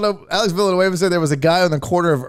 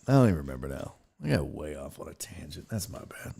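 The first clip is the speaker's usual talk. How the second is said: much softer, dropping to a near whisper; trailing-off apology; 11 dB quieter, faster than usual. Real, not acted. Host admitting he went off topic, mumbling softly.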